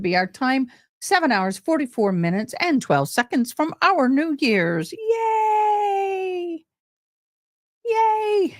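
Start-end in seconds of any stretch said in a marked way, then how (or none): 4.9-8.6 long, high squealed cheer; celebratory cheer. Real, not acted. Host counting down to New Year's, high drawn-out cheering.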